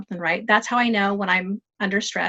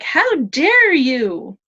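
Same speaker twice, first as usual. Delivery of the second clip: hammed-up indignation, drawn out loud and high; very slow, louder, higher-pitched retold outrage; mock outrage; 5 semitones higher, 6 dB louder, much slower than usual. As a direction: stretch it out in mock fury. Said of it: "So I got mad".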